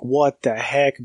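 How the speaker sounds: usual speaking voice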